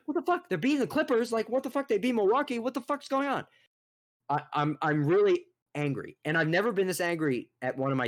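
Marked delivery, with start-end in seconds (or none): 0.1-3.5 much higher, stunned disbelief; incredulous outburst. Retold or imitated. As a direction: replay your shock at the score. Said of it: "and I'm like".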